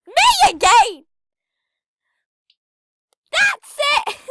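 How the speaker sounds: loud, high-pitched outburst; exasperated shout. Real, not acted. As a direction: shout it out, fed up.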